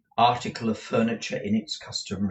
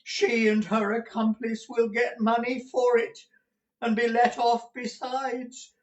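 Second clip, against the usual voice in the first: put-on high-pitched old miser's voice; frantic old man, pitch held high; distraught raving; 13 semitones higher than usual. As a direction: rave on in panic, heedless of the listener. Gride raves on, not hearing Ralph.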